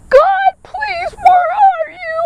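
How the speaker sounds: very high desperate plea; crying out. Acted. Believer crying out to a silent God, high and desperate. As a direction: call out to God as if abandoned. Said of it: "When we're crying out".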